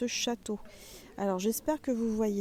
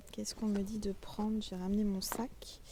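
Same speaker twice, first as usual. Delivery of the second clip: normal talking voice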